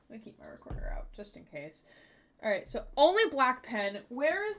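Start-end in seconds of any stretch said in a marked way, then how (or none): 0.1-1.8 whispering. Real, not acted. Quiet aside.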